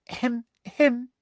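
high, dainty little cough; fussy hem-hem; affected throat-clearing. Acted. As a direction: interrupt primly. Umbridge interrupts with fussy cough.

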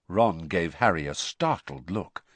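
ordinary delivery

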